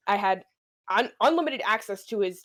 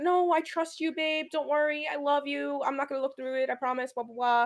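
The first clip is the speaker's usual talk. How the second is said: high, sweetly innocent reassurance; little innocent act; 6 semitones higher than usual. Retold or imitated. Girlfriend reassures boyfriend to get his phone, mock innocent. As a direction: play innocent in a raised, coaxing voice. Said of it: "I gave him that little innocent".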